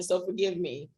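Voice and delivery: ordinary delivery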